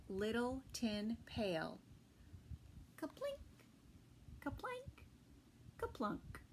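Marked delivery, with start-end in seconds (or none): 3.0-3.4 squeaky high plink sound; high tiny plink of a berry; kaplink. A narrator's reading. Sound effect words in story. 4.4-5.0 high, soft plink; light high berry drop; kaplank. A narrator's reading. Sound effect words in story.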